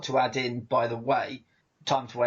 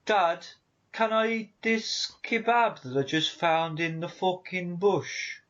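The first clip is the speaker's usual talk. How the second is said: higher-pitched, hammed-up Brummie child; attempted Brummie; 4 semitones higher than usual. Child reporting to Dad, badly faked Brummie. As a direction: put on a comic Brummie accent. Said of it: "they have a Brummy accent".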